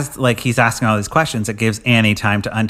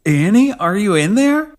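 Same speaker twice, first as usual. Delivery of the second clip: high, slow mock concern; dumb husband; 8 semitones higher, slower than usual. Husband checks on his wife in the box, high. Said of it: "he's like".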